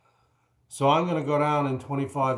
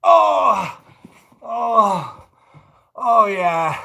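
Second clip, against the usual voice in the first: relieved gasps; 6 semitones higher, 6 dB louder, much slower than usual. Real, not acted. Relief after plank.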